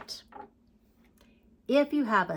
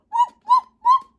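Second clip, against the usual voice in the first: very high, loud little yips; puppy barking; 11 dB louder than usual. Acted. Teacher voicing the puppy in a story, high puppy bark. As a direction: yip like a tiny puppy.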